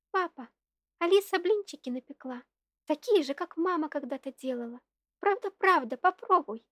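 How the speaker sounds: put-on high, loud chirping little girl; cartoon princess. A narrator's reading. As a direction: chirp it out like a happy child. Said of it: "весело прощебетала она".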